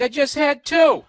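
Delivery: loud, high, drawn-out protest; exaggerated defensive son, stretched and loud; mock protest. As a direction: plead it way up high, hammed-up. Acted son answering dad about beers.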